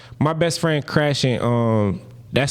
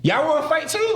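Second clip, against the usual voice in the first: high-pitched retold comeback; defiant challenge; 12 semitones higher than usual. Sailor facing all the Chiefs, high defiant challenge. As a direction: pitch leaps up, squaring up for a fight.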